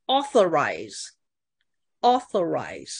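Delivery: "authorize" slowly, with weight on AU; stress on first syllable. Shown as a demonstration. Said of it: "动词的重音是第一音节". (“authorize”放慢，重音在第一音节 AU)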